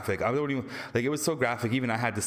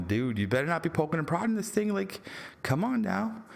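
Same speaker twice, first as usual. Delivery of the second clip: half-joking scold, rising high at the end; a touch of exasperation at the screen; mock warning; 7 semitones higher than usual. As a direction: warn him off like a worried friend. Warning the scientist in a movie.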